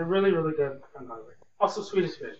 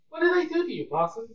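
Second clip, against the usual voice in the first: high-pitched outraged question to the possum; startled outcry; 10 semitones higher than usual. Retold or imitated. Retelling the hissing possum at Target, a high startled outcry. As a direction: squeak it out, baffled and offended. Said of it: "I'm like".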